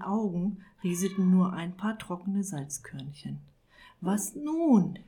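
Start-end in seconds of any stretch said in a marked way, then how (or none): 4.0-5.0 voiceless croak of "Was nun?". Acted. Despairing wizard alone in his chair, croaking "Was nun?". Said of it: "krächzte er".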